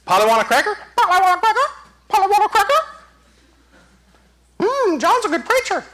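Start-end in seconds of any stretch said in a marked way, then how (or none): 1.0-2.9 high, squawky parrot voice. Acted. Parrot repeating its trainer's words, in a high bird voice. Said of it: "train a parrot to talk right". 4.6-5.8 high-pitched talking parrot. Acted. Trained parrot praising the preacher, in a high bird voice. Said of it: "train a parrot to talk right".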